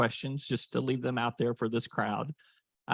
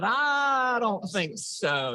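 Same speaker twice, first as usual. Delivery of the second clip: incredulous; 9 semitones higher, much slower than usual. Retold or imitated. Retold refusal, slow and high.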